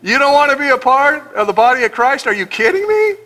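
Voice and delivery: high, mock-incredulous voice of Paul; scoffing Paul, hammed up. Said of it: "He said".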